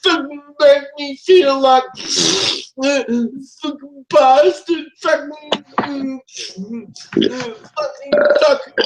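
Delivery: raging yells pitched high; furious rant. Real, not acted. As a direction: vent pure fury at the game. Raging at player in game.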